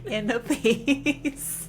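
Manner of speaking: hammed-up meditating stepdad; mock serene. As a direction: intone it like a guru. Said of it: "George just sat there like".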